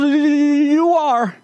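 high little squirrel voice; timid squirrel. Acted. Squirrel answering the lion: high, meek squirrel voice. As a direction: answer like a cowed small animal, high. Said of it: "And the squirrel goes".

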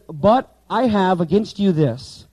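slow, deliberate word-by-word reading; slow literal reading. Shown as a demonstration. Teacher showing class the Greek word order, slowly. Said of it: "Okay, now watch this".

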